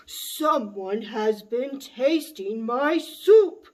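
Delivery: big, slow, loud bear roar; roaring Papa Bear. Acted. Papa Bear finds his soup tasted, roaring slowly. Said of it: "he roared".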